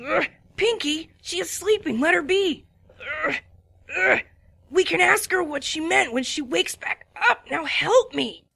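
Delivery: strained, effortful voice; a touch of strain while lifting; a hint of a grunting Spike. A narrator's reading. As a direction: groan with the weight. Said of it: "grunted Spike".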